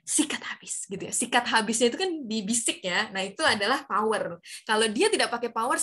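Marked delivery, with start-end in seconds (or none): 0.1-0.9 ad-style soft whisper. Shown as a demonstration. Teacher copying a shampoo ad slogan, whispered. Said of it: "ada suara bisikan gitu di iklan Clear".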